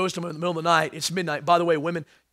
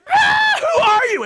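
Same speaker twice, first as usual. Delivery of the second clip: put-on shrieking high and loud like a frightened girl, with a laugh; hammed-up girlish shriek of fright; girly scream; 9 dB louder, slower than usual. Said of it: "screamed like a girl".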